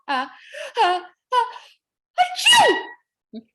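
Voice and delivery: rising uh uh uh into a very high loud achoo; building sneeze. Acted. Pine cone catches a cold, building to a high loud sneeze. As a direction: wind up and burst into a big sneeze. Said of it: "heard the little pine cone go".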